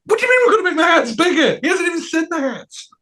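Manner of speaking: fast, very high squawk of disbelief; hammed-up squeaky incredulity; mock outrage. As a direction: throw it back in indignant falsetto. Acts sculptor's outraged echo.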